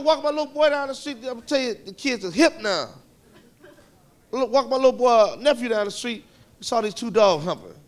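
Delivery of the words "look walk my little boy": normal talking voice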